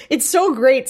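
ordinary talk, nothing marked

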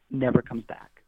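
ordinary delivery

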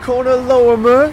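put-on high, hammy British accent. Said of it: "Just say that in your British accent".

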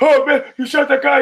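high, loud freaked-out yelp; he can't believe what he just saw, high and loud; panicked disbelief. Retold or imitated. Driver freaking out after Kevin shoots the dealer, high panicked yelp.

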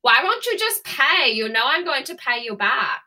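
hammed-up indignant Anna Delvey; Anna Delvey accent. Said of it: "oddly confident in my voice".

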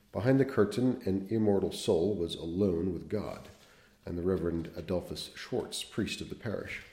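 measured literary read-aloud; unhurried storyteller voice; story narration. Acted.

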